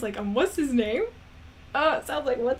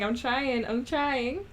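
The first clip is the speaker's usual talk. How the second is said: normal talking voice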